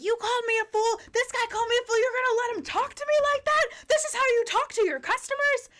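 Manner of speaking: very high, loud mock outrage; imitated outraged freak-out. Customer wrongly thinks a man insulted her, mock outraged yelling. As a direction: shriek it as an offended customer. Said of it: "she starts freaking out even more".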